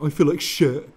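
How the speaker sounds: hammed-up loud grumble; moaning complaint. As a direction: overplay someone feeling awful. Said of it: "than going".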